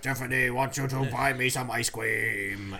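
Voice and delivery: lisping cartoon giant voice, R as W; lightly voiced cartoon giant; Dread Pirate Tiffany voice. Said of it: "the Dread Pirate Tiffany voice".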